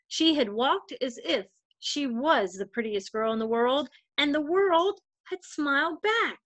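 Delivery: gentle storybook read-aloud voice; story narration. A narrator's reading. Volunteer reader telling a picture book to children, gentle storytelling.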